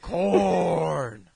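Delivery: lightly hammed-up grand voice; put-on proclaiming voice. Reviewer finishing a comic bit, mock-grand announcement. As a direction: draw the word out as a mock reveal. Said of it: "now I did that voice".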